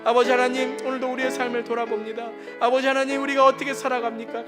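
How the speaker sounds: ordinary talk, nothing marked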